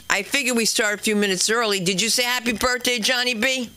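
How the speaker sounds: ordinary talk, nothing marked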